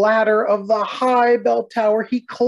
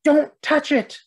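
shouting warning; 8 semitones higher, slower than usual. A narrator's reading. Bartholomew warns the trumpeter off the horn, shouting. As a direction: stop him before he touches it. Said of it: "Bartholomew shouted".

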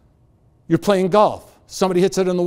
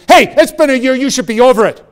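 louder and higher, shouting the cab driver's rant; retold yell; 8 semitones higher, 10 dB louder than usual. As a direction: yell it at your passenger like an angry cabbie. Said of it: "he's screaming at me".

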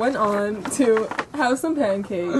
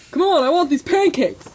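louder, higher demand; impatient; 7 semitones higher, 6 dB louder than usual. Acted. Ken hurrying Tiffany for pancakes, impatient. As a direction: raise the voice to hurry someone up.

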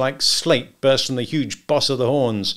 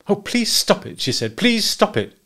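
much higher, tearful begging; pleading; 10 semitones higher than usual. A narrator's reading. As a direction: beg him through tears. Wife begging Wilson after shooting.

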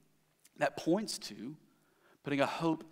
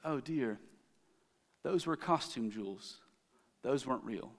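a softer, mild mock pity; gentle reveal; 2 semitones lower, 7 dB quieter than usual. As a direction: quietly let the twist land. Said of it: "and says".

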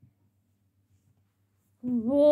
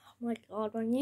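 ordinary delivery

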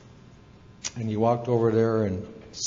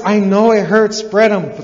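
high, loud acted yell; forceful owl coaching; urgent shouted command; 8 semitones higher, 9 dB louder than usual. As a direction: override the complaint with an order. Owl shouts back to eaglet.

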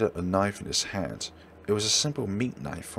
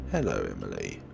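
menacing killer; 14 semitones lower, 5 dB quieter than usual. Acted. Killer greeting the girl who found the body, sinister. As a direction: let the menace hang.

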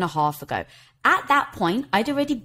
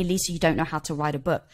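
low, quiet matter-of-fact verdict; understated voice in the head; inner critic voice; 5 semitones lower, 4 dB quieter than usual. Retold or imitated. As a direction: drop lower and softer, like a lightly voiced inner critic. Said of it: "the voice in my head".

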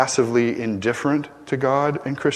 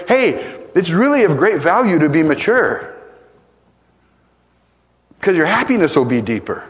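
persuasive pitch; 5 semitones higher, 3 dB louder than usual. Pretend. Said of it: "I gotta say".

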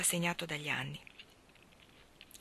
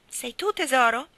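a loud, raised call up the garden path; 7 semitones higher, 8 dB louder than usual. A narrator's reading. Stanley calling to Linda from the path, loud and eager. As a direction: call out across the garden to find her. Husband calls out arriving home.

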